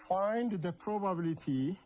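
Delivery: reads each phrase twice with long pauses for students to write; slow dictation. Acted. Teacher dictating an exam question to students, slow and repeated. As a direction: dictate the question word by word to the class.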